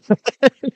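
loud squeaky laugh; high burst of laughter. Real, not acted. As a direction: break into a sudden high laugh. Laughing at the bad joke.

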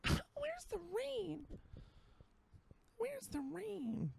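very high, soft, drawn-out mock wail; slow falsetto whine, hammed-up; mock complainer. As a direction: imitate a pining local in a tiny falsetto. Mocking Seattle people's complaint about sun.

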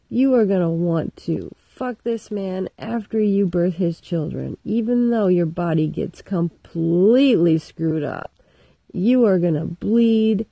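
loud berating voice; furious God. Retold or imitated. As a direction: berate her without mercy. God cursing Eve.

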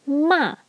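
"ma" falling from high; high falling fourth tone. Shown as a demonstration. Teacher repeating fourth tone, "ma" falling. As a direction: start "ma" high and drop it. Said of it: "That is the fourth tone".

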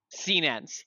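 usual speaking voice

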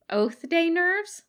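high, slow teasing woman's voice; Katsuko voice. A narrator's reading. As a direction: tease gently, drawn out.

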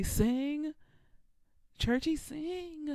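high, loud "sing, churchy, sing" like a fan in the pew; hyped church cheer. Retold or imitated. Listener cheering a gospel singer on. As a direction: egg the singer on churchy style. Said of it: "I stop and say".

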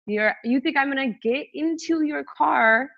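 high, incredulous challenge; retold indignant disbelief; pissed off. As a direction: ask it as if he's out of his mind. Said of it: "I was so pissed".

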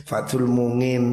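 usual speaking voice

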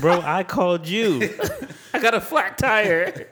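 loud, high, drawn-out complaint through laughter; hammed-up slow protest, half laughing; mock indignant protest. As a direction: wail it like a wronged victim, joking. Jokingly acts out tased caller protesting.

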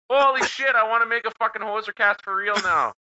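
put-on excited Canadian accent. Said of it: "That's a pretty sick accent".

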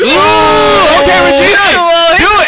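loud, high hype-up cheer; excited shout. Real, not acted. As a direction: shout her on to dance. Hyping her up to dance.

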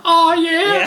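high excited "oh yeah" holler; hype shout. Shown as a demonstration. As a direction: belt out "Oh, yeah" like a hype man.

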